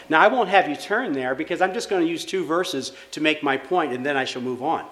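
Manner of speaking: usual speaking voice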